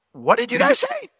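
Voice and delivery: high-pitched puzzled double-take; unsure disbelief. Acted. David checking what the soldiers said, puzzled disbelief. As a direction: ask it like you can't trust your ears. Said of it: "he hears it, but he's not sure".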